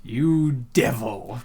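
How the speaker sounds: normal talking voice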